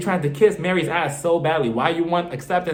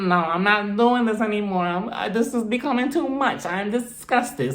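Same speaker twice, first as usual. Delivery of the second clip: mock Meredith; 3 semitones higher than usual. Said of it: "she's just like".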